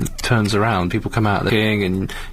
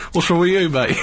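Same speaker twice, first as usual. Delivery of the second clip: cheery bloke; 7 semitones higher than usual. Retold or imitated. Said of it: "he's like".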